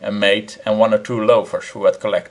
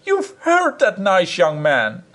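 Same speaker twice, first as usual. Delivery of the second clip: much higher, slow wife voice; furious small woman; 8 semitones higher, slower than usual. A narrator's reading. Wife to her husband, a high slow scolding. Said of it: "in a furious temper".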